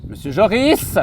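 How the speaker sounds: high, loud summons; calling out. Real, not acted. Host calls the winemaker over, loud hailing shout. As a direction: shout for someone out of sight.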